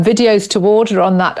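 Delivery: ordinary delivery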